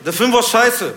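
high, loud gangster complaint; loud, pushy tough-guy voice; angry gangster customer. Retold or imitated. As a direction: demand it like an irate wannabe gangster. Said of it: "so ein Gangsterpärchen".

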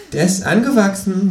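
high, cheeky kangaroo voice; high, sulky kangaroo. Acted. Kangaroo's voice in the story.

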